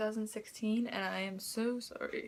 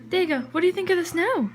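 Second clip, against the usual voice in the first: high, loud voice for the dog; high dog-talk voice; 9 semitones higher, 10 dB louder, faster than usual. Real, not acted. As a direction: coo at the dog, high and bright. Talking to her dog in the snow.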